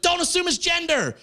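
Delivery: very high imitated girlfriend protest; mock indignant outcry. Girlfriend indignantly objects, retold.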